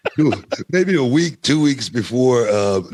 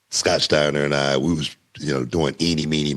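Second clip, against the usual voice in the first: ordinary delivery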